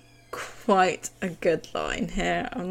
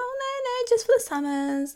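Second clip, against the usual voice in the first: airy high "Oh, no, no"; lightly mocking high-pitched protest; high mock denial. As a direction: brush it off in a high voice. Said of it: "she's like".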